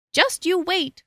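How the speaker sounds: high little goat voice. A narrator's reading. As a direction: tempt the troll in a small, high voice. Little goat tempts the troll.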